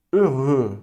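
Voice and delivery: heureux said in French, lips rounded, final x silent; French word. Shown as a demonstration. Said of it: "Qual que é a diferença?".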